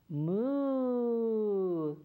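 long drawn-out lowing moo; cow moo. Shown as a demonstration. Storyteller leads kids in a mooing chorus, with a long cow moo. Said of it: "Let's do it again".

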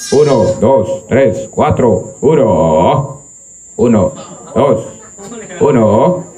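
low, slow mic test count; mic check. Real, not acted.

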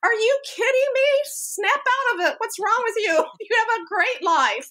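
high, mock-scolding pep talk; exasperated scolding. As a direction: snap your daughter out of her moping. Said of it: "I went".

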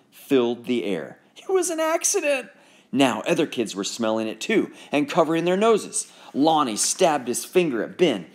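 ordinary delivery

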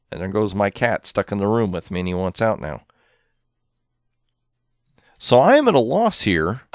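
ordinary delivery

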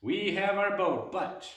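bright burst of energy; suddenly thrilled, then stopping; excited. Acted.

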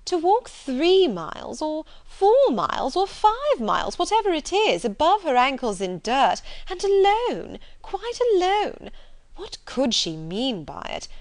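high, loud, swooping society outrage; scandalized Miss Bingley. Acted. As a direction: pile up each item in theatrical disbelief. Reader voices outraged Miss Bingley.

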